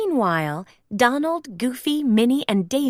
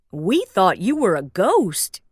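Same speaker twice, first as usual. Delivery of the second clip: Goofy voice; 3 semitones lower than usual. Acted. Goofy speaks.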